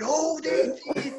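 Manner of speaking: high, slow imitation of a pitch-side call; Brian Reid. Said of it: "that's what Big Reid would shout".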